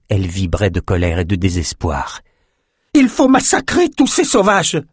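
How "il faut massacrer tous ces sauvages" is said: very high, furious cry; cri de rage désespérée. A narrator's reading. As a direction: shriek it in anger and despair. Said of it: "cria-t-elle". (cri suraigu de colère et de désespoir)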